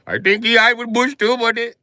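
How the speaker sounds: high, loud mock Indian accent; chirpy agreeing friend. Clerk agrees about Bush, in a high mock accent.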